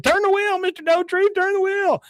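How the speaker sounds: put-on squeaky boy cheering from the side; Bobby's voice. Bobby voiced in retold episode.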